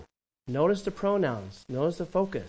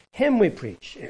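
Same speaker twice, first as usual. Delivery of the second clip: loud, high proclamation; forceful preaching; 6 semitones higher, 6 dB louder than usual. Acted. Preacher proclaims the verse, loud and high. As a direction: proclaim it to the congregation.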